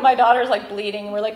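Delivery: normal talking voice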